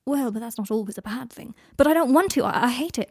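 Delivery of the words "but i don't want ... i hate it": raised, distressed voice; upset protest. A narrator's reading. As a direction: push back, upset and loud.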